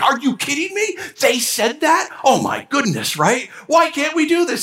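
high, disbelieving outburst; outraged coworkers. Retold or imitated. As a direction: staff venting about the lying boss.

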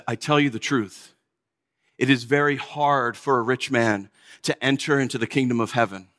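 low, measured pronouncement; solemn teaching. Retold or imitated. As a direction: deliver it as a grave teacher to gathered followers. Quotes Jesus teaching the disciples.

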